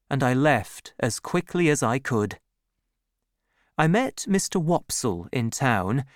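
usual speaking voice

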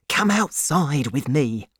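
ordinary delivery, nothing marked

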